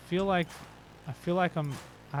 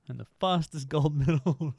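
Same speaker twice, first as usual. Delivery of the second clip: breaking into laughter on the words; laughing; 3 semitones higher than usual. Real, not acted. Gamer mocking a silly boast, laughing. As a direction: crack up while finishing the boast.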